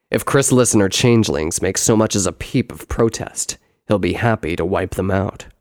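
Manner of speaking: loud, low brother's voice; deep, forceful elder-brother character; explaining brother. Acted. Brother warns of Gravitas.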